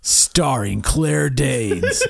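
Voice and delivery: slow booming trailer announcer; movie trailer voice. Pretend. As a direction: read it like an epic trailer. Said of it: "I want the voice again".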